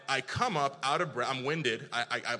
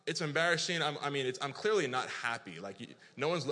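normal talking voice

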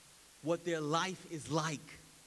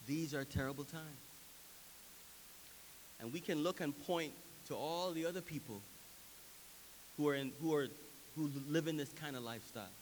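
normal talking voice

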